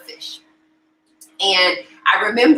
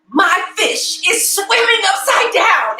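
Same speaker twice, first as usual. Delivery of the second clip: high, slow, drawn-out proud announcement; hammed-up excited boy, every word stretched; excited child; 11 semitones higher, 4 dB louder, slower than usual. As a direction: imitate a thrilled kid announcing big news. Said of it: "he was so excited".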